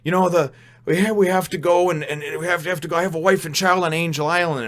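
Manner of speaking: lightly acted frantic plea; panicked prisoner. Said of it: "And he says".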